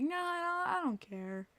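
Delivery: mock indifferent whine, trailing down; mock not caring. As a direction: lightly mimic a team that can't be bothered. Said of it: "They just were like".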